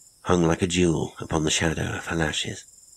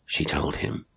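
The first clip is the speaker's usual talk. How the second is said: usual speaking voice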